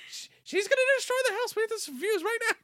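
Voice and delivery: shrieking high, rapid panic; hammed-up frantic squeal, words tumbling out; Pearl. Pearl fearing for the house, frantic high shriek. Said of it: "Pearl's is based on fear".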